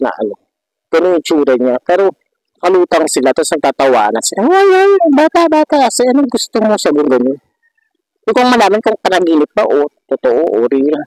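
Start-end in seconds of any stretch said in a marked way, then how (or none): 4.5-6.8 tiny dwarf voice. Retold or imitated. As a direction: stretch it out high like a tiny floating elf. Dwarves call the child.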